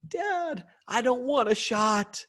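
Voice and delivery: put-on high, whiny little girl pleading; scared little girl. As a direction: whimper it like a five-year-old dreading a shot. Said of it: "What do you think her response is?".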